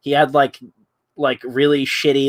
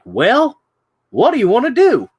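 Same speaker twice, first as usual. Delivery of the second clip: much higher, louder odd toy voice; creepy toy voice; 11 semitones higher, 6 dB louder than usual. Retold or imitated. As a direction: squeak it out like a bootleg talking bear. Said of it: "It was this really weird ass pitch".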